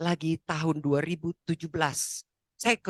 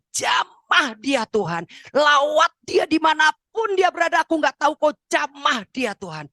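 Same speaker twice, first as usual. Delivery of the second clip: loud, high cry to God; acted impassioned plea; urgent prayer; 8 semitones higher, 6 dB louder than usual. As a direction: cry out in fierce intercession. Mother praying urgently for son.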